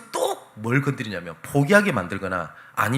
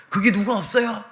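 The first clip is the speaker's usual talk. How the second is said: crying out again; 9 semitones higher than usual. Retold or imitated. Young man ignores God and shouts up the cliff again. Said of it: "다시 위 부르짖더래요".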